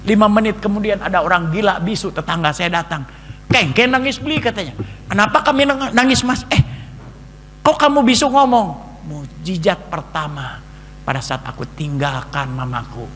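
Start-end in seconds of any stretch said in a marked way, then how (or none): 3.5-8.8 high, loud astonished voices; excited neighbour. Retold or imitated. Mute neighbour suddenly talks, high astonished voice. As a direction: act the village amazed.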